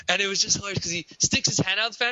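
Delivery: ordinary delivery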